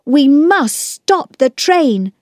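put-on high, slow, emphatic boy's call; urgent shout. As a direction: press the urgency, leaning on each word. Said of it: "Peter shouted".

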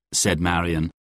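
ordinary delivery

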